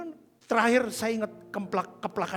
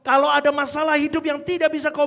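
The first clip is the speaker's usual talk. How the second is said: forceful pulpit voice, louder and higher; preacher's loud exhortation; 6 semitones higher, 9 dB louder than usual. Retold or imitated. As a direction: thunder it like a pastor pressing the flock. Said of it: "Pendeta itu ngomong".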